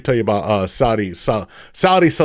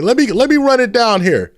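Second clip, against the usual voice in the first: pitched up, louder hype; excited shout-outs; 10 semitones higher, 4 dB louder than usual. Real, not acted. Streamer hyping his chat, excited. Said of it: "hyping up live chat".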